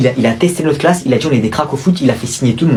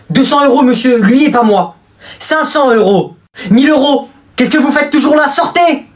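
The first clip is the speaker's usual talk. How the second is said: escalating bids; 10 semitones higher than usual. Acted. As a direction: raise the stakes, pushier each time. Bribe bidding then teacher ejects.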